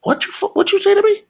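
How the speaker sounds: high, strained mock tough-guy challenge; tough guy confrontation. Actor squaring up to Adam Sandler, mock menacing. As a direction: squares up to someone, voice jumping up. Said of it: "I was like this".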